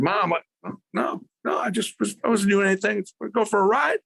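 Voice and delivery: put-on high, nervous boy voice stumbling over excuses; a ten-year-old caught out, protesting to Mom; flustered kid. Boy talking to his mother, high and flustered.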